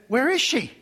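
the demanding way to ask, set against the polite one. Shown as a demonstration. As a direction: press her for an answer, not polite. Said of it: "Very polite".

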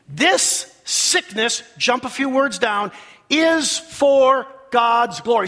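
slow, loud, high proclamation; big deliberate pulpit delivery; preacher's declaration. Shown as a demonstration. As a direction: proclaim it slowly to the whole congregation. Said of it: "Say that with me".

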